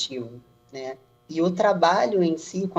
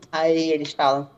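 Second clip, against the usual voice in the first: normal talking voice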